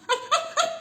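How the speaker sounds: high laughing mock shriek of alarm; retold shriek. Shrieking on the escalator as her family topples, laughing. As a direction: shriek it again, half laughing. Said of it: "talagang nagtitili ako".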